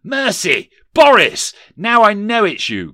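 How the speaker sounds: high, very loud exclamations; frightened cry. Acted. Scrooge recognises the ghost, crying out high and loud. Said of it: "cried Scrooge".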